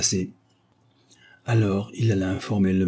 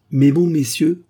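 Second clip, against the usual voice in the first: higher, louder opening appeal; addressing the council; 6 semitones higher, 6 dB louder than usual. A narrator's reading. Old widower opening his plea to councillors, oratorical. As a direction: hail the assembly to win their ear.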